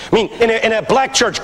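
ordinary talk, nothing marked

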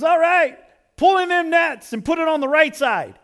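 high, loud hollered order; calling out instructions. Retold or imitated. Jesus telling fishermen where to put the net, calling out. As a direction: shout directions out to the boat.

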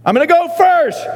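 high, loud interjection; acted insistent holler; retold forceful shout. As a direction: cut in with a high-pitched yell. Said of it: "I said it just like this".

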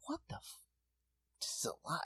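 whispered under her breath, stunned; a quiet, half-voiced what-the; hushed disbelief. Private reaction to the bags.

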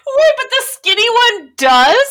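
high laughing incredulous question; loud, high and disbelieving. Real, not acted.

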